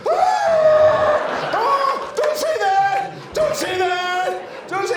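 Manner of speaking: very high hammed-up wailing scream; imitating terrified crying. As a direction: wail it in exaggerated panic. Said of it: "he starts crying".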